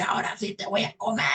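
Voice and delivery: high, strained devil shout; enraged devil. Acted. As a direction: threaten to devour in a hammed-up roar. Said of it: "esta vez se enfurece".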